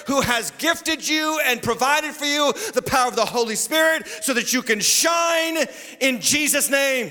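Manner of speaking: high, ringing preacher's cry; full-force preaching. Real, not acted. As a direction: preach it high, stretching out the last phrase.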